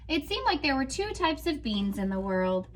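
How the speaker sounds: expressive storybook reading; reading to children. Acted. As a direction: read it out like a picture book to a class. Teacher reads picture book aloud.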